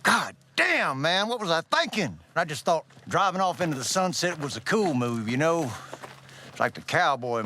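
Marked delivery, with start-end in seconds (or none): none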